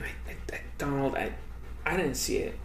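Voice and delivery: very soft, half-whispered excuse; small hushed voice, barely there; Melania. Pretend. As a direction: play a timid wife murmuring. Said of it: "you're gonna be Melania".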